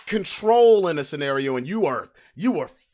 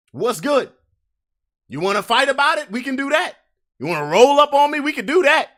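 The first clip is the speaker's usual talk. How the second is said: higher, louder, fast challenge; fired-up dare; 7 semitones higher, 5 dB louder than usual. Acted. Challenging the ex to fight, loud and fast. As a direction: square up and dare them.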